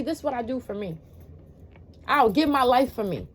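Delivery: normal talking voice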